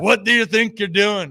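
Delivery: put-on failed Spanish accent. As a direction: botch a Spanish accent into Irish. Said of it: "let me show you my Spanish accent".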